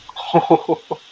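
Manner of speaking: short villainous chuckle; a quick menacing laugh; evil laugh. Acted. Voicing the enemy's taunting laugh, short and evil.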